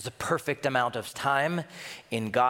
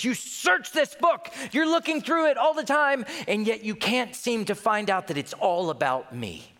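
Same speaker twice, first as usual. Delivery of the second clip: Jesus to the Pharisees; 8 semitones higher than usual. Acted. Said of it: "He says".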